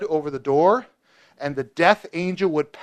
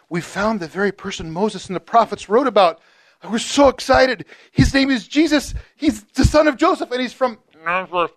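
excited Philip; 6 semitones higher, 4 dB louder than usual. Retold or imitated. Philip excitedly tells his brother.

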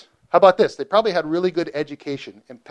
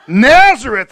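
louder, higher laughing scoff; 8 semitones higher, 10 dB louder, slower than usual. Retold or imitated. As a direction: laugh the name off in disbelief. Nathanael reacts scornfully.

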